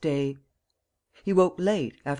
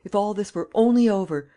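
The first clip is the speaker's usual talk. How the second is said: rising to a loud, higher anguished cry; despairing cry; 6 semitones higher, 6 dB louder than usual. A narrator's reading. Lupin alone in his cell, crying out in despair. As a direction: cry it out like a prisoner at the end of his rope. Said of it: "he cried".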